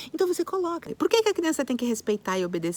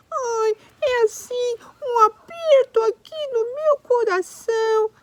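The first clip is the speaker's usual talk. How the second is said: crying monkey; 13 semitones higher, 8 dB louder, slower than usual. Pretend. Monkey describes feeling.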